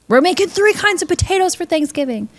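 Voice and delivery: high-pitched, emphatic sitcom character; Monica impression. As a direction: announce it in a high, put-on voice.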